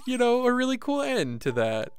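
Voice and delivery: usual speaking voice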